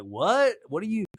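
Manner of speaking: high, confused mock bafflement; high, laughing mock confusion of a younger boyfriend; baffled. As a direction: sputter like you can't believe what you heard. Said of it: "Corey's like".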